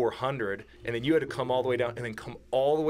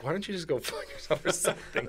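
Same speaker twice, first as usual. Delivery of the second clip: mock irritated brush-off, a bit higher; annoyed retort; 5 semitones higher than usual. Hunting partner pestered for water, imitated with a grumpy edge. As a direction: imitate a tired hunter snapping back at his buddy. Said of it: "I could tell in the tone in your voice".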